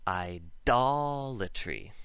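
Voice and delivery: "idolatry" with "DOL" loud and drawn out; slow, exaggerated stress on DOL in "idolatry"; stressed hard D. Shown as a demonstration. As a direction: hit and hold the second syllable of "idolatry" with a hard D. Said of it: "would have the hard D".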